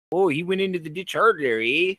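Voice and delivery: hammed-up Canadian with a rising tag; Canadian accent. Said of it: "Because they all talk like this".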